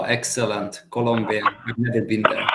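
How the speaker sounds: ordinary delivery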